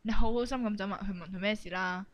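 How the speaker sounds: ordinary delivery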